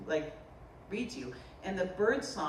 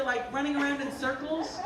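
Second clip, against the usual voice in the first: normal talking voice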